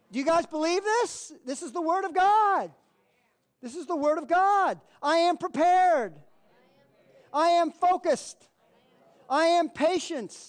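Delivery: rousing pulpit declarations, punched on am; full-force preaching. Acted. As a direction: call out each claim like a preacher on fire. Preacher leads affirmations at full force.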